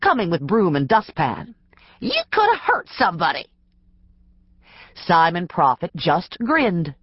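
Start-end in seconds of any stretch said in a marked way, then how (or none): 2.0-3.4 scolding. A narrator's reading. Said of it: "his clerk scolded".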